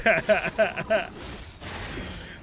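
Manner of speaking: long, high, loud laughing fit; loud laughter. Real, not acted. Storyteller cracking up at the Marine imitation, loud laughter. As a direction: burst out laughing.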